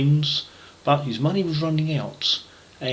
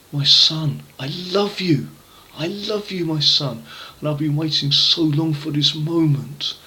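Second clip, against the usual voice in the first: much higher, joyful and tender; loving welcome home; overjoyed father; 5 semitones higher, 3 dB louder than usual. Acted. Father welcomes his returning son, joyful and tender.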